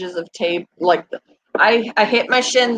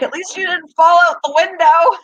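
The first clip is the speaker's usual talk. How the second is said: higher, louder teasing quip; teasing son; 8 semitones higher, 5 dB louder than usual. Retold or imitated. Son joking about her stair fall, teasing. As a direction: tease her like a cheeky son. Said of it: "he was like".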